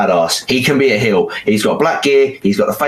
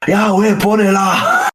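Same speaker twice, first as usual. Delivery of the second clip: high, slow, put-on voice; mock wrestler intro; 11 semitones higher, slower than usual. Imagining the wrestlers in a Tyne pub, high slow mock voice. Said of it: "and it's like".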